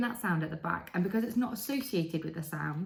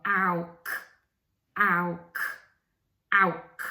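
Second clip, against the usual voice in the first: louder ow, k; 6 dB louder than usual. Shown as a demonstration. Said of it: "the other way around".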